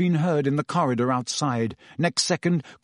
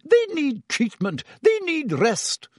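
very high, slow indignant insistence; sputtering protest; 13 semitones higher, slower than usual. A narrator's reading. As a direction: sputter protectively for your patients. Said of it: "sputtered Madame Pomfrey".

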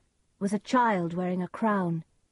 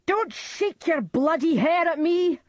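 much higher, loud horrified yell; terrified scream; 11 semitones higher, 7 dB louder than usual. A narrator's reading. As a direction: scream in terror at the ghost. Macbeth screams at ghost.

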